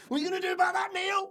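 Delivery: high, hammed-up challenge to a brawl; mock fight challenge. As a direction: taunt him in a squeaky fake tough voice.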